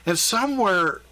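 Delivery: ordinary delivery, nothing marked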